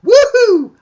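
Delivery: very high, loud mock whoop; imitated woohoo. Host imitates the viewer's reaction, excited whoop. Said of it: "Right now, she just went".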